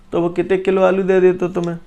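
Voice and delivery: ordinary talk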